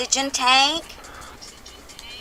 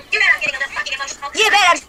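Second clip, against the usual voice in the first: loud, higher heated yell; angry outburst; 5 semitones higher, 4 dB louder, much faster than usual. Real, not acted. Woman yelling at trolls on her channel, furious. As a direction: let the anger spill over.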